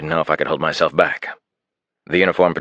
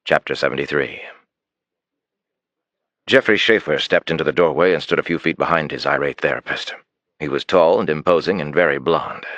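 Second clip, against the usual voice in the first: usual speaking voice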